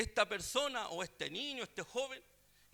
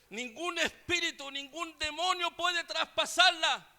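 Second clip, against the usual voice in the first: higher and louder, slowed insistence; witch yelling; 7 semitones higher, 6 dB louder than usual. Retold or imitated. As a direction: hammer it out like a desperate sorceress. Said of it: "le gritaba".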